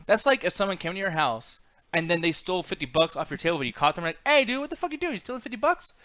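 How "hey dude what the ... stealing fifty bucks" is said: high, indignant "what the fuck"; startled confrontation. Retold or imitated. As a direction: catch the thief red-handed. Catching a thief at home.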